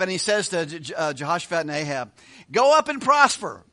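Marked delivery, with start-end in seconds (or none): 2.5-3.6 mock proclamation, high and loud; hammed-up sarcastic cheer. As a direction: pronounce it loudly with mocking assurance. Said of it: "he's being sarcastic".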